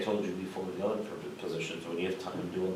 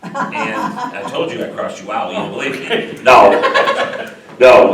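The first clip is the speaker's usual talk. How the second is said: laughing; 4 semitones higher, 20 dB louder, slower than usual. Real, not acted. Teasing a colleague about the meeting's start.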